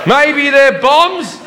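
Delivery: squeaky high, second line very slow; a nervous traveller blurting it out; high panicky self. Retold or imitated. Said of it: "And I went".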